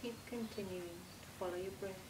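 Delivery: soft, hushed meditation voice with long pauses; guided meditation. Acted. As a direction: lull them gently through a body relaxation.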